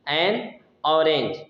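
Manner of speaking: slow, "एन" given full weight; stress on an. Shown as a demonstration. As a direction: stress "एन" before orange. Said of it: "जोर दिया". ("एन" पर ज़ोर, धीमे)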